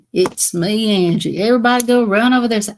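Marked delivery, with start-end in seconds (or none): none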